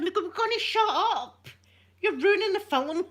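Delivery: hammed-up fed-up husband; Mike snapping. Mike hushing her during the film, mock exasperated. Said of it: "Honestly, Mike said".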